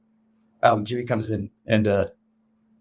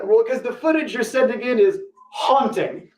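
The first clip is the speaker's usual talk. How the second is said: drawn-out, higher worried coworker; slow, higher editor voice; 9 semitones higher than usual. Retold or imitated. Editor telling him his footage is haunting, slow and higher. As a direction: play the editor, slow and pitched up.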